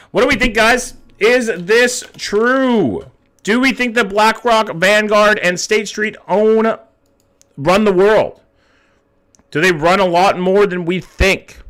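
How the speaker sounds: high, drawn-out game-show announcer. Acted. Streamer puts Jackson's theory to a vote, grand announcer. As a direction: stretch the big question out for the crowd. Host puts poll question to chat.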